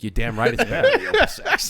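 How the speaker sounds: high, laughing, gleeful crow; triumphant exclamation. Retold or imitated. Host reliving his proud answer about his cigar, laughing high. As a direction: crow it out, delighted and cocky. Retold triumphant reply to the praise.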